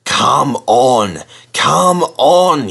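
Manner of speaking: straining, rising effort; puffing hard. A narrator's reading. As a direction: push through the strain. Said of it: "he puffed".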